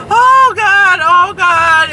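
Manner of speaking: high, loud mock cry of pain. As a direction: imitate a patient moaning in pain. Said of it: "And the lady's going".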